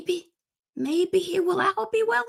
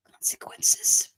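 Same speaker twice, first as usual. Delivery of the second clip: threatening whisper; slower than usual. Pretend. Mock threat to the striker.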